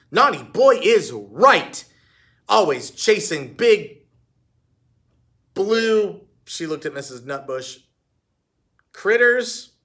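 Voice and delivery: loud, slow, pointed scold. A narrator's reading. Heidi scolding the dog to needle Missus Nutbush, slow and pointed. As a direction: scold the dog while jabbing at the woman.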